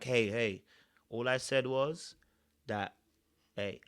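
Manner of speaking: soft, slow, unruffled imitation of Ayo; calm imitation. Showing how Ayo calmly shut Uma down, soft and slow. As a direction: keep it calm so nobody can argue. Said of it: "he was so calm about it, like".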